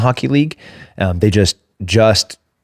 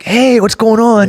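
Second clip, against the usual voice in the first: much higher, slow sing-song hello; lake greeting; 14 semitones higher, 3 dB louder, slower than usual. Retold or imitated. Imitating lake folks waving at a passerby, high sing-song hello. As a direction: call across the water cheerily. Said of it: "waving and saying hi nonstop".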